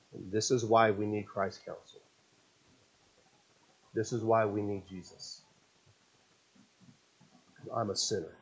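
soft, low sincere admission; quiet confession. Real, not acted.

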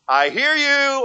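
playful sing-song imitation; mock deer voice. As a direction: stretch it out as the deer catching you out. Voicing the alert deer.

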